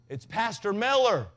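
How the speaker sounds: loud, slow shout at the intercom; yelling into the speaker. Retold or imitated. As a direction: call it out slowly and loudly, like the speaker is far off. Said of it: "He still does that at drive-thrus".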